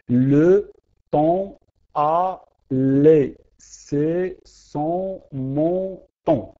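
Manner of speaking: very slow, every syllable separated; teacher scanning a poem line syllable by syllable; syllable count reading. Shown as a demonstration. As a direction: tap out each syllable of the verse. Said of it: "Nous avons huit syllabes".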